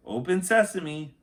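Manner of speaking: put-on girl voice. Annie says magic words.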